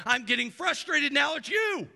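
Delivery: snappy frustration. Retold or imitated. Preacher voicing the frustrated friend snapping back, irritated. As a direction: turn the frustration on the asker.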